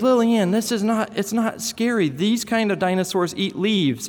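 put-on high, earnest little boy explaining. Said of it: "And he says".

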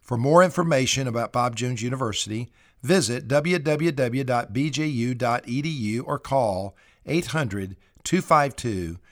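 announcer sign-off. Acted. University president thanks listeners of the programme, announcer sign-off. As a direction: read it as a programme's closing announcement.

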